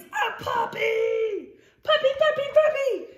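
high, loud puppy cries; excited yell. Acted. Pigeon telling readers its dearest wish, yelling with excitement. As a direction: yell it with strong feelings. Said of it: "which means we yell it".

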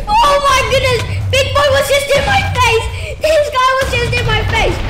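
high, loud shocked shouts; startled yelling. Real, not acted. Startled gamer yelling at the mob in his face. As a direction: yell like it jumped right at you.

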